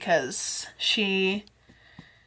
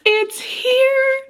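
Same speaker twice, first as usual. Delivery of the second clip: very high, drawn-out squeal after laughing; overjoyed squeal; 3 dB louder than usual. Real, not acted. Reader holds her long-awaited book, overjoyed squeal. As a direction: let joy shoot the pitch right up.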